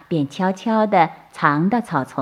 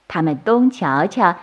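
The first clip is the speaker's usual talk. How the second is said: usual speaking voice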